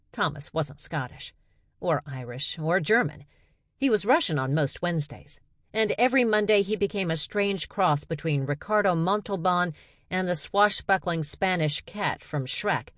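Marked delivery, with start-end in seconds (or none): none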